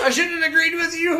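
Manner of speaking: high, loud hammed-up regret; mock anguished shout. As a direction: shout the regret through laughter. Mock plea to the old crush.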